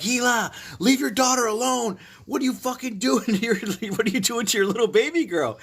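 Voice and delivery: high-pitched, exasperated retold scolding; scolding Hila. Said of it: "I kinda was telling Hila".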